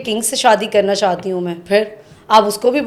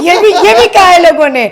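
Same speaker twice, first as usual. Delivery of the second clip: laughing; 7 semitones higher, 7 dB louder than usual. Real, not acted. Laughing reply to the teasing.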